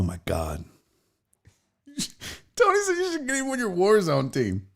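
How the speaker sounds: ordinary talk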